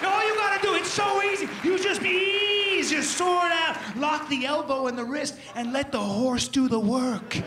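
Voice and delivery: high, loud, slow and triumphant; gleeful battle cry. Acted. Riding past the carolers on horseback, in a loud gleeful bellow. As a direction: bellow it like a charging knight.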